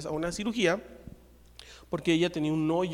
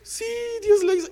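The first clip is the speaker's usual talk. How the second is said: very high, loud wail; hammed-up tearful joy; imitated overcome cry; 5 dB louder than usual. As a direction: squeal it out like the healed woman. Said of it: "por eso ella hizo".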